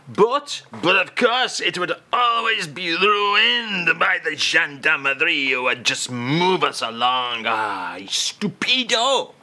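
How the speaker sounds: a comic Frenchman grumbling about the police; hammed-up indignant French accent; mock French accent.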